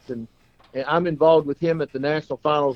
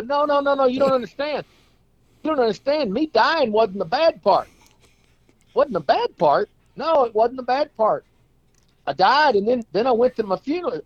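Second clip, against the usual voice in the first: much higher, hammed-up protest; insistent clown; 10 semitones higher than usual. Clown correcting his friend, hammed-up insistent. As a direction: wave off his pity, insisting.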